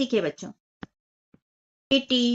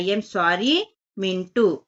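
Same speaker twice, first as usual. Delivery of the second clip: slow model phrase; 4 semitones lower, much slower than usual. Shown as a demonstration. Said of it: "ये बोल रहा है".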